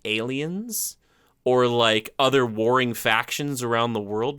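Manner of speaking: ordinary talk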